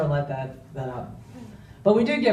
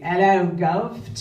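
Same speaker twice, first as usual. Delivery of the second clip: slow, hammed-up Cockney London greeting; Cockney accent; 3 semitones higher, 5 dB louder, slower than usual. Father's old Cockney greeting, imitated in a Cockney accent. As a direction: lay on a thick Cockney accent, stretching it out. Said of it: "the Cockney".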